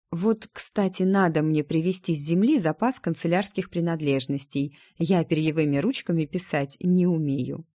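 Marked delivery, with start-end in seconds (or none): none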